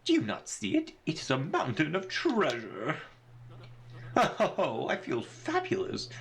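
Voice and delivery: grandiose adventurer, swooping up high; delighted braggart launching into his tall tale; theatrical, self-admiring flourish; pompous Flavio. Acted.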